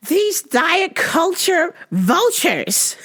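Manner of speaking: high, slow mocking voice; high, slow mocking impression of Demi. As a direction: drag it out in a high mock whine. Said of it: "was like".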